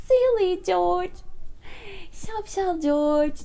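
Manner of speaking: high, drawn-out storybook teasing; mock amusement. A narrator's reading. Peppa teasing George, high mild amusement. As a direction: stretch the name as if amused. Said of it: "diye gülüyor Peppa".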